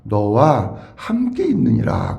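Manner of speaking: low, slow, solemn divine voice; weighty, measured pronouncement; God's promise. Acted. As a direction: speak as God assuring Moses, drawn out. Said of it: "무슨 말씀을 하시고 있느냐고".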